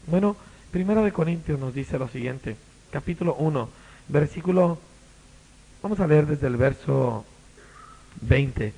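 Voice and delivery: ordinary talk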